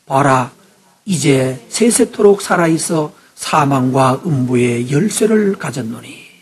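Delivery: slow, loud solemn Bible reading; scripture reading. Acted. Pastor reading Revelation to the congregation, slow and solemn. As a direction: read it out slowly like the pulpit Bible. Preacher reads scripture passage aloud.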